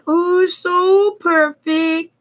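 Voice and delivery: high, drawn-out prissy mockery; mock fussy crafter. Crafter mocks perfectionist crafters, high fussy voice. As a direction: stretch it slowly in a high, precious voice. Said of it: "I'm not one of those particularly snickety".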